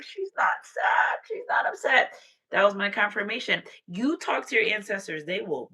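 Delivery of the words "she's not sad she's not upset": retold tearful cry. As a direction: cry it out high, voice giving way. Retells tearful relief, getting emotional.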